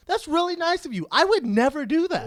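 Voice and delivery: high, loud disbelief; amazed gratitude. Retold or imitated. Comic thanking a generous seatmate, amazed and high-pitched. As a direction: gush in astonishment at the kindness.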